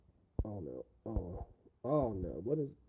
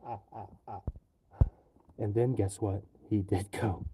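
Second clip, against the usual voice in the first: normal talking voice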